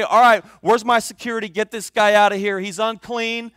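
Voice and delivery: loud, hurried call for security; mock flustered orders. Preacher imagines ordering security to remove the man, mock flustered. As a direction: call out to have someone thrown out, half in jest.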